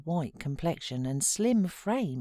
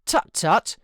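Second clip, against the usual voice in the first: impatient tut; 3 semitones higher, 7 dB louder than usual. A narrator's reading. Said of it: "said Heathcliff".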